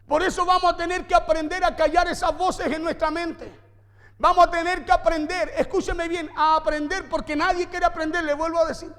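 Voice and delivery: loud, driving sermon delivery; forceful preaching. Acted. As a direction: preach it hard to the congregation.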